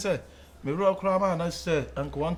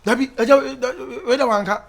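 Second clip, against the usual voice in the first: high, loud flustered denial; stammered, high-pitched objection; loud protest; 7 semitones higher, 11 dB louder than usual. Acted. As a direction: protest loudly in a raised pitch. Confronted by the father, protesting.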